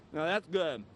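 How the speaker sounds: talking through a bite of cake; mouth full. Real, not acted. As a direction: say it while chewing. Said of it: "talking with my mouth full".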